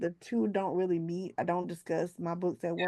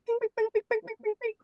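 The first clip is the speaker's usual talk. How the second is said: very high chirpy pings; high-pitched bing sounds; notification pings; faster than usual. Retold or imitated. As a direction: mimic a chat app pinging nonstop. Imitating Teams notification sounds.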